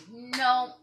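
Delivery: sharp loud no; emphatic no. Retold or imitated. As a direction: shut the idea down hard. Insisting to the vet.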